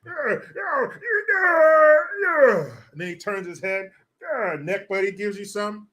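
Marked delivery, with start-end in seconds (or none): 0.1-2.7 high mock climax moans; hammed-up climax cries; mock moaning. Mocking climax noises.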